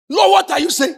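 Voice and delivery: pleading shout heavenward; crying out to God. Retold or imitated. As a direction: call out in confusion to the Lord.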